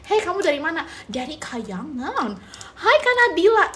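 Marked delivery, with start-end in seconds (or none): 2.8-3.7 excited greeting. Real, not acted. Menyapa penonton dengan gembira.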